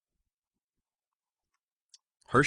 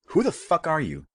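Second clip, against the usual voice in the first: higher, sharp furious demand; angry hiss; 11 semitones higher, 5 dB louder than usual. A narrator's reading. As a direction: spit the question out at him. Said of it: "Carrie hissed".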